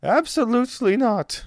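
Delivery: high, dramatic voice with broken English; fretful noblewoman. Acted. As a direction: wring your hands over the poisoning. GM voices Olga.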